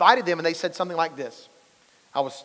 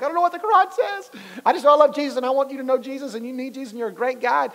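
eager pleading; 8 semitones higher than usual. Retold or imitated. Pastor's eager pitch retold.

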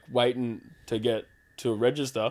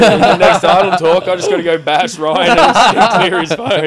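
much louder, laughing sign-off; loud hammed-up outro; 4 semitones higher, 11 dB louder than usual. Real, not acted. Wrapping up the episode to the audience, loud and laughing. Mock outro, joking threat.

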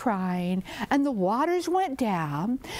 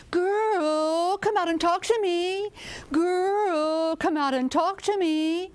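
high, drawn-out calling voice; snake calling out; 7 semitones higher, slower than usual. Acted. Snake calls the girl from under the house, calling high. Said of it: "they heard someone calling".

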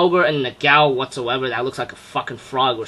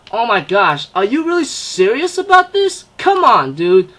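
disbelief; 6 semitones higher than usual. Retold or imitated. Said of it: "I'm thinking in my mind".

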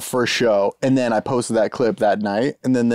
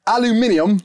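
mock Australian "Aluminium", five syllables, pitched up; imitating how Australians say "Aluminium"; 9 semitones higher than usual. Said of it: "how they say aluminum is infuriating".

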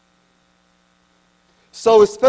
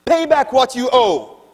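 harsh demand; 6 semitones higher, 6 dB louder than usual. Acted. Master choking fellow slave.

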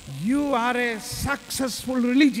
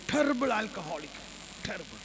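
ordinary talk, nothing marked

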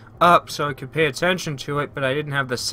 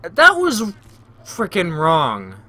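annoyed outburst; 2 semitones higher, much slower than usual. Real, not acted. Annoyed at a bad jump in game.

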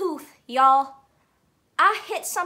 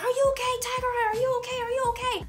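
imitating kids, pitched higher, asking anxiously; mock the crowd of worried kids, high and fussing; 8 semitones higher, faster than usual. Kids crowd around the hurt speaker.

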